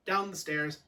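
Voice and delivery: plain reading voice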